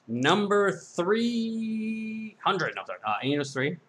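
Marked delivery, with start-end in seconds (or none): 0.1-2.8 drawn out. Acted. Drawn-out joking price call.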